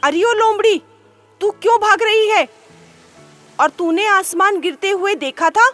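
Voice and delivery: a king interrogating the fox; commanding lion. A narrator's reading. Lion questioning the fox, commanding. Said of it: "शेर ने लोमड़ी से पूछा".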